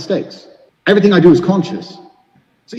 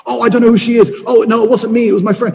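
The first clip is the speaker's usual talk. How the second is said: higher, hammed-up fake innocent denial; mock lying excuse; 6 semitones higher than usual. Cheater caught by girlfriend lying to her, higher mock-innocent voice. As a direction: play the caught cheater squirming out of it. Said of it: "and I'm like".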